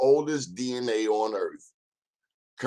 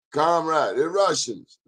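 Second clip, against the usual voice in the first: higher mock Russian accent, Comrade; second try Russian accent; 6 semitones higher than usual. Host retries his Russian accent for listeners, still hammy. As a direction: another go at sounding Russian. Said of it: "I gotta work on that".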